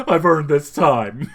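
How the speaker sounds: slow, loud, about to cry; Patrick Warburton. Retold or imitated. Fan imitating Kronk's actor about to cry, slow and tearful. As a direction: drag it out as if holding back tears. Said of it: "but, like, he's about to cry".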